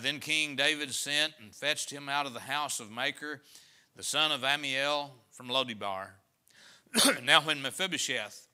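solemn pulpit reading; scripture reading. Acted. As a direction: read the verse out to the congregation. Reads scripture aloud.

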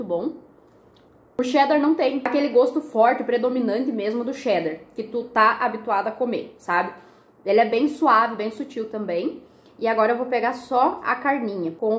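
small bite in mouth; chewing between words; talking while chewing. Real, not acted. Seen 1: Takes cheddar on fork at 1.3, chews through remaining frames.

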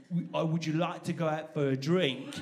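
slow, polite English invitation; English accent. Acted. English person inviting someone for a drink, polite English accent. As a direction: put on a careful English accent, slowly. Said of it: "the difference between you saying".

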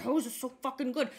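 fast, baffled outrage at great food; confused and angry. Retold or imitated. Diner stunned by delicious cake, fast confused outrage. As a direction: rattle it off like you're mad it tastes this good. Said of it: "confused and angry while you're eating".

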